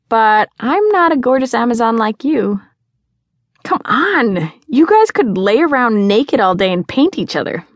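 put-on high, animated girlish coaxing; teasing urging. A narrator's reading. Girl pushing her friend toward the hot artist, coaxing. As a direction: egg your friend on. Friend eagerly pushes the match.

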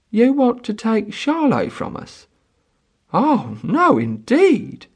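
lightly voiced peasant woman, high; taken-aback mother. A narrator's reading. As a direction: protest as a startled mother. Peasant mother refuses to give child.

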